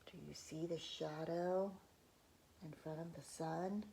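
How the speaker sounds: ordinary delivery, nothing marked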